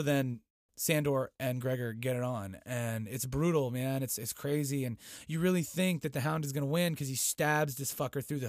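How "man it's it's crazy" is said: ordinary talk, nothing marked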